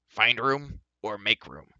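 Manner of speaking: loud, slow, firm command; ordered. A narrator's reading. Dwarf orders reluctant doorkeeper to make room, commanding. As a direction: order it with heavy emphasis. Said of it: "he ordered".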